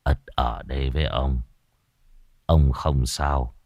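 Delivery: low, subdued, unhurried reassurance; ailing grandfather. A narrator's reading. Bedridden grandfather lets Ất stay by him, low and weary. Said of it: "Nhưng cụ phất tay".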